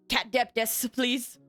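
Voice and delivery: usual speaking voice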